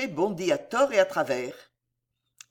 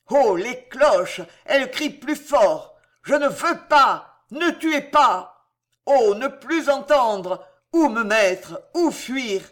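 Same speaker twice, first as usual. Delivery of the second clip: frantic, furious yelling at the bells, slow and loud; king screaming; 4 semitones higher, 5 dB louder, slower than usual. A narrator's reading. The king screams at the ringing bells, furious and panicked. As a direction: roar at the bells in terror and rage. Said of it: "Le roi vociférait".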